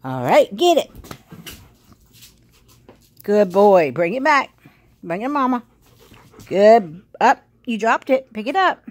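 high, loud puppy-talk praise; praising the dog. Real, not acted. Owner coaching her dog through fetch, high and loud. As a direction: squeal encouragement at a dog fetching.

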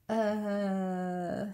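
long, level, uneasy "uhhh"; hesitant "uh". A narrator's reading. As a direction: stall with a drawn-out filler. Fox stalls, afraid of heights.

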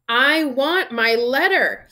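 slow, loud, high demand; shouting. A narrator's reading. Boy demands his letter, shouting. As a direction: shout each word insistently. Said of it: "he shouted".

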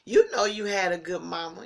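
ordinary talk, nothing marked

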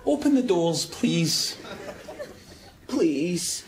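low, reluctant polite request; pleading, subdued. Acted. Trapped man saying please to the lift, subdued and polite. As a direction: give in and ask nicely, pitched low. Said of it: "Maybe we should just say please".

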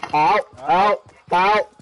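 loud rising help calls; mock cry for help. Calling for help in game.

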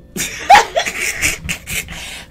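breathy high-pitched giggle; high squealing laugh. Real, not acted. Tarot reader squealing with laughter at her viewers. Laughs after saluting Libra.

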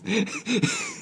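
shrill squealing laugh; high squeal laugh. Real, not acted. Player cracking up at the rock line, squealing laugh.

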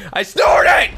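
loud, high, laughing yell; laughing shout. Real, not acted. Laughing at own snort.

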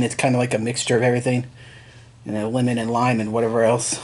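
talking over a held drink; words slightly blocked by can; speaking with can at lips. Real, not acted. Seen 0: Can rim held at or just below lips while talking throughout.

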